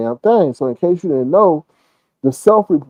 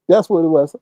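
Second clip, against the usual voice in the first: normal talking voice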